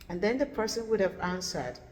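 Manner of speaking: ordinary talk, nothing marked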